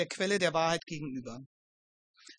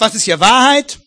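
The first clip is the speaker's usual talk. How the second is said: angry, much louder outburst as Pilate; 13 dB louder than usual. Acted. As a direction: snap the question out in irritation. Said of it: "Oder ob er das ärgerlich sagt". (ärgerlich, deutlich lauter)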